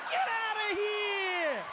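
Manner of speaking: high, slow delighted shout. Real, not acted. Reacting to the President's Trump joke, a delighted shout. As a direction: howl it out in disbelief.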